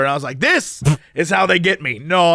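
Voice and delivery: ordinary delivery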